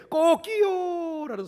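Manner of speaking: high, slow stretched rooster crow. Retold or imitated. Preacher imitating God's wake-up rooster call, high crow. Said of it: "소리를 좀 들려줬으면".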